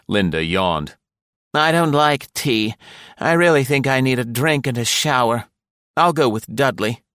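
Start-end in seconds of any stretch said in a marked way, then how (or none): 1.5-7.0 higher-pitched woman's voice, weary; weary wife. A narrator's reading. Tired wife turns down tea after the trip, wearily. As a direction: complain wearily in a raised pitch.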